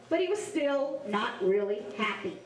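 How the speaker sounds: plain reading voice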